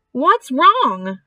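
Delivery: announcer thrown off his speech; startled loudspeaker announcer. A narrator's reading. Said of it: "asked the loudspeaker".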